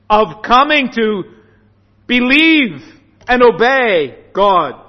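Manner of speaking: loud, weighty phrases with pauses between; emphatic preaching. Real, not acted. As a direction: proclaim it firmly, phrase by phrase. Preacher drives home the call.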